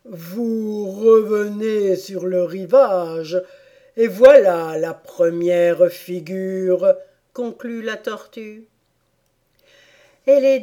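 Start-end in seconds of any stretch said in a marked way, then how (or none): 0.0-6.9 loud, slow storybook turtle; ceremonious turtle. Acted. Turtle concludes the first dance figure, loud and ceremonious. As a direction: announce it slowly and loudly, with ceremony.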